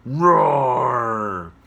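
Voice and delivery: loud, long pretend lion roar. Showing kids how an angry lion sounds, loud pretend roar. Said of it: "You're a lion".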